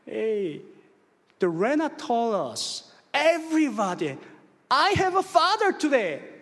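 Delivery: put-on high, slow, proud little girl's announcement; excited child boast. Daughter telling everybody about her father, excited and high. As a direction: imitate a child bragging to everyone.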